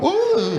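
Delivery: high swooping "ooh"; imitated bird swoop. Magpie swooping at a walker, imitated high "ooh". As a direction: mimic a diving bird with a high hoot. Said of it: "see this little sumbitch go".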